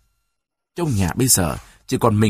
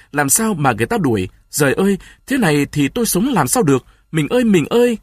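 rising anguished cry; 5 semitones higher than usual. Acted. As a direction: cry out to the wife in despair. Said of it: "vừa nói vừa rít".

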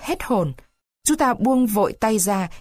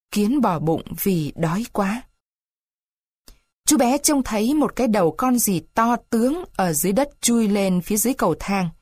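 usual speaking voice

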